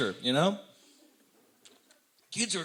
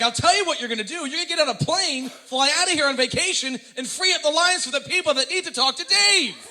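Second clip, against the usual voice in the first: louder, higher mock rant at the caller; yelling at the radio; 8 semitones higher, 4 dB louder than usual. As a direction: tell the caller off from your car. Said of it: "I'm, like, in my car".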